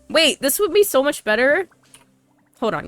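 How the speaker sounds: normal talking voice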